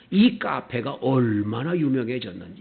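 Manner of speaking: ordinary talk, nothing marked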